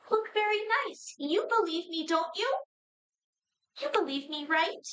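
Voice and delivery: high-pitched little puppet; tiny high doll voice, questioning; pleading puppet voice. Acted. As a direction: squeak it high, seeking to be believed. Faded puppet speaks to Norvian.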